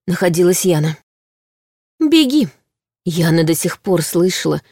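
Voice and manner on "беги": mocking. A narrator's reading. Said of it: "это насмешливое предложение".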